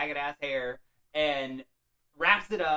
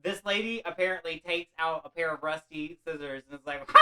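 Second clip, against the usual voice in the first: usual speaking voice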